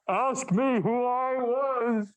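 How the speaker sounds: high, slow, hollow ghost voice. Acted. Marley's ghost teasing Scrooge, high and slow ghostly. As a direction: draw it out like a phantom from beyond. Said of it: "I'll do my ghost voice".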